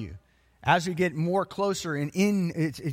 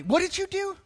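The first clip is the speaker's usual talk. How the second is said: high-pitched, incredulous mock parent; disapproving parent; 8 semitones higher, 4 dB quieter than usual. Parent confronting a newly converted child, shocked and high-pitched. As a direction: play a parent scandalized by their child.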